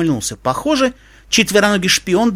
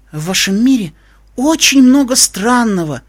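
squeezed out, bewildered; 7 semitones higher, 4 dB louder than usual. A narrator's reading. Stunned pony finally speaks after the wolf antics, bewildered. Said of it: "выдавил, наконец, из себя Скрепик".

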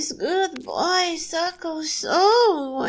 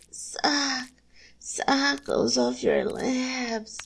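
ordinary talk, nothing marked

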